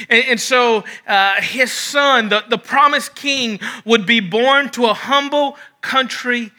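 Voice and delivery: fervent preaching. Acted. Preaching at raised pitch.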